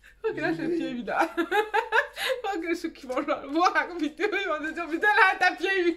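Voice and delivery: high voice bubbling with giggles; laughing while talking. Real, not acted. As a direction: giggle through the whole remark. Still giggling about the tease.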